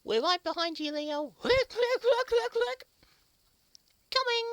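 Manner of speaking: high, loud cheerful reply; high character voice. Acted. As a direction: call back brightly. Characters answer leader.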